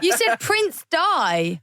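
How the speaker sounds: high, drawn-out correction; slow, high-pitched teasing protest; exasperated correction. Real, not acted. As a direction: pitch leaps up, words stretched out. Co-host corrects the slip, laughing.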